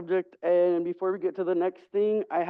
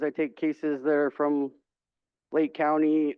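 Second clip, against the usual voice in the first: ordinary delivery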